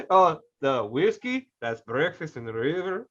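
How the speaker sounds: slow, hammed-up movie accent; Jon Voight accent. Actor from the snake movie, slow terrible accent. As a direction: drag it out in a bad put-on accent. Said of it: "when he's doing that accent".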